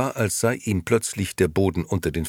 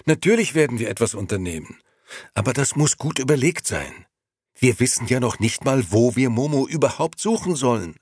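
put-on higher, hurried young man calming his friend; Gigi, quickly soothing; 7 semitones higher, 3 dB louder than usual. Gigi calming Beppo, higher and fast. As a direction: talk fast to settle him.

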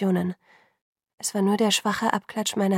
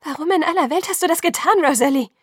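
high, loud outburst of reproach; heated demand; 8 semitones higher, 7 dB louder than usual. A narrator's reading. Alice confronting Rosalie on the phone, heated demand. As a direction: demand an answer, voice raised. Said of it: "biss sie die Zähne zusammen".

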